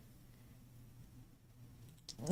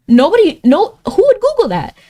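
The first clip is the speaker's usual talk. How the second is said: incredulous; 10 semitones higher, 3 dB louder than usual. Real, not acted. Incredulous at a game answer.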